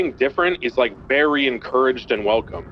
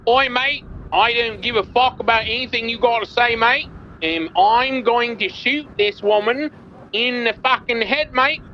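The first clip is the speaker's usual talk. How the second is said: put-on Australian accent; 10 semitones higher than usual. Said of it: "All right, pretend I'm Australian".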